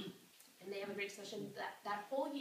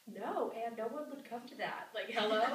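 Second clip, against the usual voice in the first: mock scoffing, hello punched louder; a touch of valley-girl sass; sassy sorority girl; 5 dB louder than usual. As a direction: scoff at the idea like it's obvious. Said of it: "she's like".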